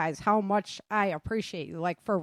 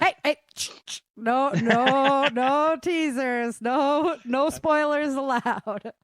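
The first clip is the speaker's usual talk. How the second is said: playful higher mock warning, stretched out slowly; mock scolding; 4 semitones higher, 3 dB louder, slower than usual. Host teasing a cast member to stop a spoiler, playful mock scolding. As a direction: wag a finger at him, half joking.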